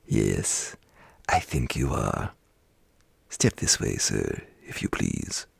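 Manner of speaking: a hint of a stern old boss; mock-stern employer. A narrator's reading. Scrooge feigning stern boss.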